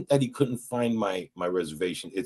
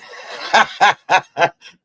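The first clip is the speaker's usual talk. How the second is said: loud laugh; 10 semitones higher, 8 dB louder than usual. Real, not acted. Big laugh at own punchline.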